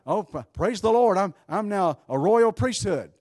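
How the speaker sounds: mock exultant testimony, pitched high and loud; high, hammed-up praise; exultant believer. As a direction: proclaim it like a proud believer. Voices a believer claiming priesthood.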